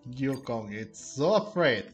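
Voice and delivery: normal talking voice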